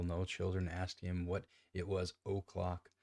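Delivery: plain reading voice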